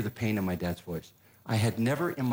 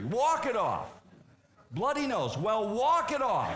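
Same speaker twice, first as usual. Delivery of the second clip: high mock dad dismissing every injury; tough dad; 10 semitones higher than usual. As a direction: brush it off like a gruff father. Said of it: "my dad's solution to any injury".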